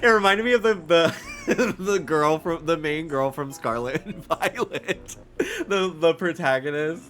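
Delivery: amused, laughing chatter. Real, not acted. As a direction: giggle while you explain the resemblance. Laughing while describing lookalike.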